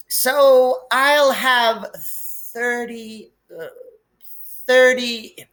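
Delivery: very slow, high, effortful counting; slow Dudley. A narrator's reading. Dudley works out his present count, very slow and high. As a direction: drag out each word like hard sums. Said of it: "he said slowly".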